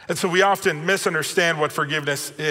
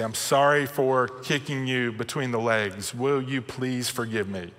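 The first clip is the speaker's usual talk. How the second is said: soft, low, sincere "I'm sorry", softer; humble apology; 4 semitones lower, 5 dB quieter than usual. Shown as a demonstration. Dad shows his sons the humble way, softly. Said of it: "Okay, we're humble".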